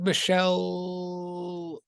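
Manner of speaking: name stretched very long and slow; drawn-out greeting. Real, not acted. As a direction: hold the last syllable of the name. Playful drawn-out roll-call greeting.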